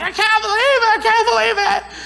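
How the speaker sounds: very high, whiny repeated disbelief with a laugh; hammed-up wail of the worked-up self; mock upset disbelief. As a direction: son venting to dad, very high mock wail. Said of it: "I was mad".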